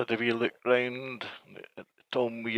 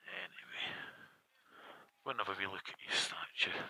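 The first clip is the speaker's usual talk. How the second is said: whispering; 2 semitones lower, 10 dB quieter than usual. Real, not acted. Quiet aside near the statue.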